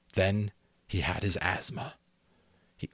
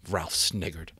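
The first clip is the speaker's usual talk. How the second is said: plain reading voice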